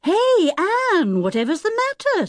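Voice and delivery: high, startled call; taken aback by his sister; amazed. A narrator's reading. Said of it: "amazed to hear".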